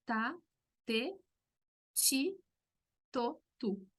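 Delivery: ta, te, chi, to, tu with ti said chi; t syllable drill. Shown as a demonstration. Teacher drilling Brazilian t syllables, chi for ti. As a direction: run the syllables, chi in the middle. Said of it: "nosotros hacemos".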